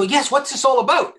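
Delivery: high, eager imitated reply; mock the candidate's keen curiosity. Candidate eagerly answering the headhunter's call, high and keen. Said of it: "And they go".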